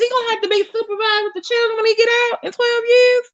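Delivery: worried question held way up high; real upset, voice stuck high; high-pitched alarm. Real, not acted. Worried about supervised visits with children, high alarmed voice.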